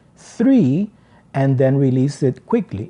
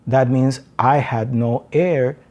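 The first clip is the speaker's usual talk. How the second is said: ordinary delivery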